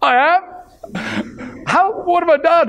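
high, startled disbelief; shocked. Retold or imitated. Young Joe reacting to his teacher's verdict, high and startled. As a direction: pitch up in alarmed surprise. Shocked reply to being called wicked.

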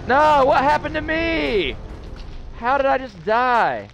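high, slow stunned cry; real shock at dying out of nowhere; shocked disbelief. Real, not acted. Gamer yelps in disbelief after dying. Shocked at sudden death in game.

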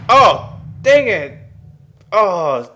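high, loud dang it; a real groan of frustration; frustrated outburst. Real, not acted. Gamer losing a fight, loud frustrated outburst.

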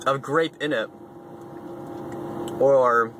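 chewing while talking; crunchy food in mouth; mouth full of apple. Real, not acted. Seen 0: Bit slice at -4; jaw moves, chewing while talking, slice in hand.

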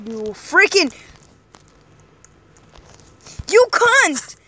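furious yell, high and loud; angry shout. Real, not acted. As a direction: scream it in rage. Angry outburst at player.

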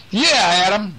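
high calling voice across a distance. Acted. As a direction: call up to the giant toddler. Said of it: "Nick called".

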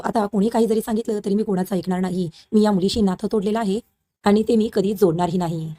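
plain reading voice

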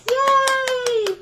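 high, loud happy cheer. Acted. Librarian cheering as the story ends, excited. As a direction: celebrate the end of the book.

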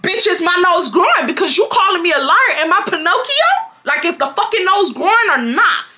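high, indignant rant; retold angry outburst. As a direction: tell her off in rising outrage. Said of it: "I really got mad".

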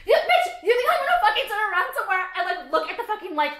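high, loud laughing rant; laughing outburst. Real, not acted. Vlogger shrieking at the water bottle label.

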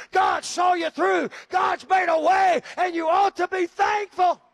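loud, high shouted preaching; loud, high sermon shout; preaching at full force. Acted. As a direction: whoop it to the rafters.

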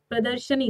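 प्रदर्शनी said pradarshani, short a before the sh; प्रदर्शनी with no i after sh; correct pronunciation. Shown as a demonstration. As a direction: say प्रदर्शनी clearly. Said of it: "उच्चारण सही से करिए".

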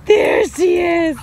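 loud, drawn-out excited call; excited greeting. Real, not acted. Greeting the dog who has just reappeared, excited. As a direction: cheer the dog's appearance, stretching it out.

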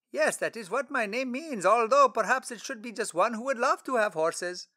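a cheerful stranger making a joke about his name; friendly traveler Philip. Acted. Philip voiced.

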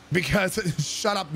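ordinary delivery, nothing marked

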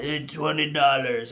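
put-on rough go at a Russian accent, low and soft; attempted Russian accent. Russian barber quoting a price, attempted Russian accent. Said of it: "I can't do a Russian accent".

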